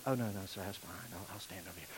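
poor visitor meekly giving way, nearly whispering; meek poor man. Retold or imitated. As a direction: shrink away, barely audible.

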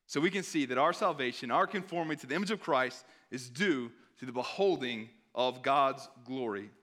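normal talking voice

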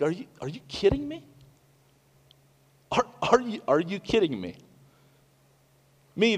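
high, incredulous mocking scoff; mocking God. God scoffing at rebels trying to escape, incredulous mockery. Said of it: "that is mocking".

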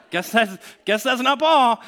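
high, loud amused exclamation; comic realization. Acted. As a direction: repeat the punchline over laughter. Repeats punchline over laughter.

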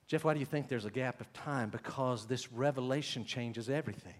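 normal talking voice

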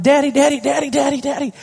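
put-on high, insistent little girl calling; daughter calling daddy. Daughter running up to daddy, high and insistent. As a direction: call daddy over and over like an excited child. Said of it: "my daughter comes up".

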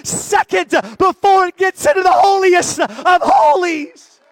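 fiery sermon, shouted and slow; preaching at full force. Acted. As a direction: preach it out loud to the church.

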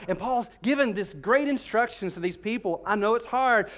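ordinary delivery

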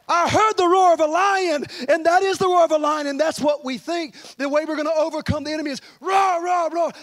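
high, forceful preacher's shout; fervent preaching. Real, not acted. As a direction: cry it out over the congregation.

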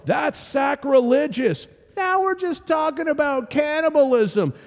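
high, appalled outcry; scandalized crowd. Retold or imitated. Unsettled crowd reacting to Jesus, high appalled outcry. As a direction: gasp it out, shocked and offended. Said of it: "they're all murmuring".

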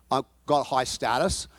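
ordinary talk, nothing marked

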